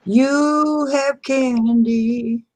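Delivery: slow, high sing-song tease; mock sing-song. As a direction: taunt it in a high singsong. Mocking sing-song reply.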